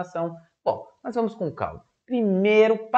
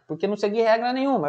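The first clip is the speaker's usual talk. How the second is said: usual speaking voice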